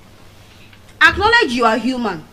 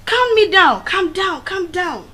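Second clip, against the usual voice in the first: high coaxing calm me down; imitating people soothing her; mock soothing; 8 semitones higher, 3 dB louder, slower than usual. Boys soothing the angry speaker, high coaxing. Retelling being calmed after fight.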